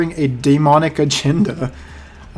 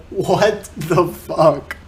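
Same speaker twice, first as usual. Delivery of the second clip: disbelief; 2 semitones higher, much slower than usual. Real, not acted. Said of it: "reacting in disbelief".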